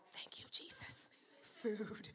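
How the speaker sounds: relieved whispered thanks. Retold or imitated. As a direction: breathe it like a hungry secret. Hungry person after a fast.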